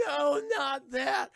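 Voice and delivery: slow, high, loud wail; hammed-up high cry of dismay; mock horrified protest. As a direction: drag out the victim's plea. Acting out victim's protest.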